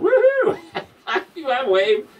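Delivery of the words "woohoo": high, loud whooping cheer; mock woohoo. Ladies cheering at a paper-bag costume, loud whoop. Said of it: "they're going".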